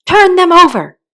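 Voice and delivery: loud, high, curt command; angry order. A narrator's reading. Angry queen ordering the knave, loud. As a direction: bark it at the servant. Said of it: "The queen turned angrily away".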